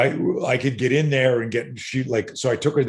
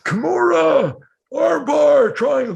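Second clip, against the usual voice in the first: high, loud mock drunken yells from the crowd; drunk fans yelling; 7 dB louder, slower than usual. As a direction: bellow it like a clueless drunk in the stands. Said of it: "all these drunks are around us yelling".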